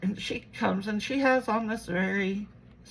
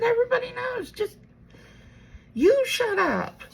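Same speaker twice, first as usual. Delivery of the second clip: exasperated order; 14 semitones higher, 4 dB louder than usual. Retold or imitated. Retells arguing with her mom.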